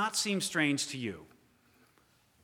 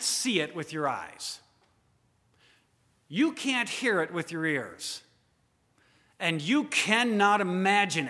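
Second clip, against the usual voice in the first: louder, urgent sermon push; fervent preaching; 3 semitones higher, 7 dB louder than usual. Real, not acted. Preacher urging the congregation, louder and fervent. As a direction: drive the point home to the congregation.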